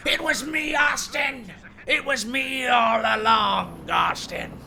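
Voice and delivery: high, drawn-out wrestling promo shout; strained high cry, sliding down at the end; Vince McMahon. Acted. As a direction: belt out a villainous wrestling reveal. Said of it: "in the famous promo".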